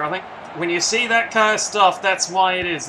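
ordinary talk, nothing marked

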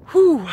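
a high, loud blown-out whew; breathless whew. Real, not acted. Vlogger at the top after the climb, blowing out a loud whew. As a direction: exhale hard, spent from climbing. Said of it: "I'm out of breath".

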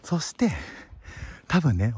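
ordinary talk

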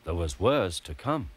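ordinary delivery

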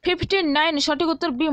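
ordinary talk, nothing marked

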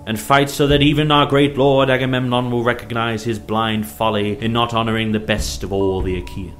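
loud, high battle rallying cry; rallying shout over noise. A narrator's reading. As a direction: shout to your troops over the din, peaking on the war cry. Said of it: "called to them over their shouts".

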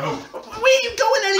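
loud, high, drawn-out question; exasperated call. Real, not acted. Calling after a mate who is leaving, exasperated shout. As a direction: call after them, stretching the words.

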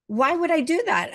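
can't believe what they're asking; incredulous. Retold or imitated. Baffled reply to odd request.

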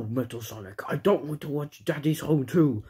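ordinary talk

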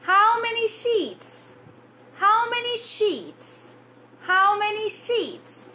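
overarticulate every sound of How many sheets; exaggerated articulation. Shown as a demonstration. Teacher drilling a tongue twister with an open mouth. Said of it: "Stretch your muscles".

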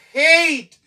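"Hate" with a long AY, high and falling. Shown as a demonstration. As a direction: stretch the AY in "Hate". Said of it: "Li vin chanje son A". ("Hate" ak son A long)

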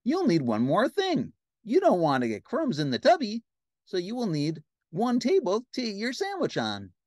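high, eager cartoon helper; Ernie. Ernie adds to the bath clutter, high cartoon voice. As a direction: pile on ideas in a high voice. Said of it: "said Ernie".

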